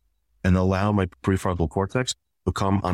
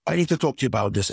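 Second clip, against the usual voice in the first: rushed, pressing, higher demand; pushy demand; 6 semitones higher, faster than usual. Retold or imitated. Spouse crowding the other's space, rushed and pushy. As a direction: crowd them with it, fast and urgent.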